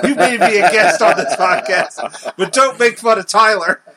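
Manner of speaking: loud, laughing fake reprimand; mock stern scolding. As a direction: scold the guest in jest, loudly.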